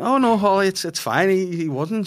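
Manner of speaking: high, loud hasty reassurance; flustered backpedalling. Retold or imitated. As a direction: overdo the denial to smooth things over. Backpedals in front of other parents.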